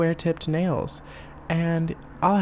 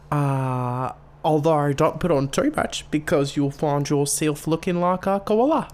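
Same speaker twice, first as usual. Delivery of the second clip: exaggerated Australian accent; shitty Australian accent; 2 semitones higher, 3 dB louder than usual. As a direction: put on a broad mock Australian accent. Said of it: "shitty Australian accent".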